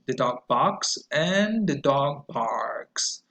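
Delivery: very slow, clear dog barks; slow model phrase. Shown as a demonstration. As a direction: read it slowly for repetition. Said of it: "sentences and phrases".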